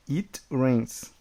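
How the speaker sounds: slow and clear: it rains; slow model reading. Shown as a demonstration. Teacher contrasting it rains slowly. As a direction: lay out each word slowly. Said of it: "somente chove".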